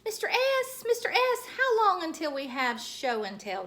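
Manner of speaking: high, loud eager call; shouting for teacher. Acted. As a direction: call out to get the teacher's attention. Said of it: "you have to stand up and shout".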